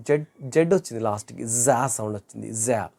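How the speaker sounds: usual speaking voice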